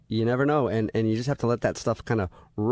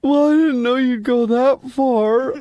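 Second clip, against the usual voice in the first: crying on the phone; 11 semitones higher, 5 dB louder, slower than usual. Acted. Fake coach keeps acting hurt.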